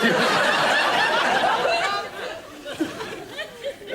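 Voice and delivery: high cackling laugh; long high laugh. Real, not acted. Comic laughs at his own jail story.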